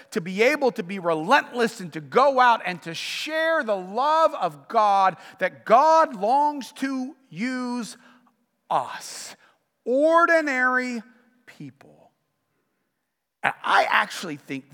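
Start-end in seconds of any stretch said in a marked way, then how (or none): 2.4-12.1 slow, deliberate, each word weighted; slow emphasis. Real, not acted. Pastor driving home his point to the congregation, slow and deliberate. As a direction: lean on every word, slowly.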